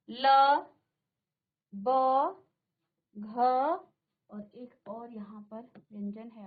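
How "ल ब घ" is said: ल, ब and घ each said alone with a pause after it; demo letters. Shown as a demonstration. Teacher sounding out each letter for the class. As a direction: sound out ल, ब, घ one by one. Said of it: "अब सभी का उच्चारण करें".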